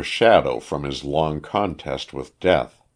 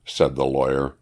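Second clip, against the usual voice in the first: ordinary delivery, nothing marked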